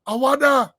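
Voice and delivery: 'Awada!' called out high and loud; mock exclamation. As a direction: exclaim it in amazed surprise. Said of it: "you can exclaim".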